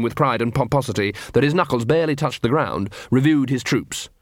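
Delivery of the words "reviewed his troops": ordinary delivery, nothing marked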